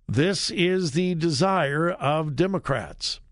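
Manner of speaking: normal talking voice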